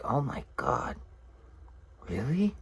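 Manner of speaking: soft, hushed imitation of disbelief; quietly awed, lifting high on the question; mock astonished woman. Woman believing a tall tale, mock soft astonishment.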